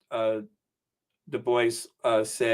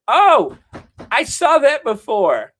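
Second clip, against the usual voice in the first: surprised; 11 semitones higher, 9 dB louder than usual. Real, not acted. Sudden recognition mid-story.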